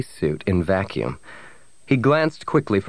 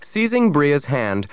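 ordinary delivery, nothing marked

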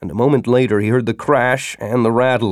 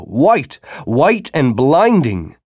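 plain reading voice

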